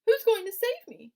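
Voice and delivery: very high, loud eager voice; eager cry. Acted. Wilbur asking who will save him, very high loud. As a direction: squeal it with hope.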